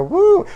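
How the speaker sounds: loud, high cheer; demonstrated woo. Shown as a demonstration. Comic showing an arm exercise, high loud woo. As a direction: whoop it as if arms are overhead. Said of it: "put them over your head and go".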